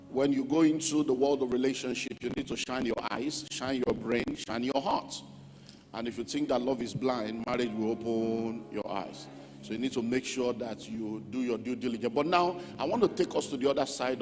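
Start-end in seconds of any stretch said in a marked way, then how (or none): none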